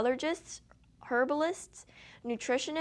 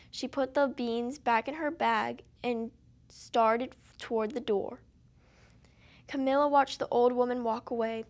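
plain reading voice

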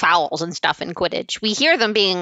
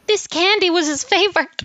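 high put-on voice, playing up the loss; mock lament; 10 semitones higher than usual. Ron announcing what his lost pet loved, mock high lament. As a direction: imitate an aggrieved mourner, pitched way up. Mimicking Ron's pointed lament for Scabbers.

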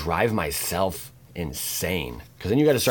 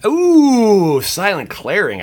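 excited shout; 13 semitones higher, 12 dB louder, much slower than usual. Real, not acted. Thrilled reveal.

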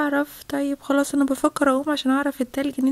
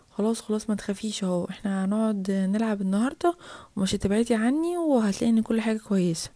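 put-on calm the child in a lowered voice; reassuring mother; 6 semitones lower than usual. Mother comforts her scared child, reassuring.

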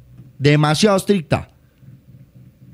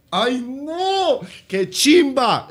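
delighted squeal; 8 semitones higher, 3 dB quieter, much slower than usual. Real, not acted. Delighted at the sight of the jersey.

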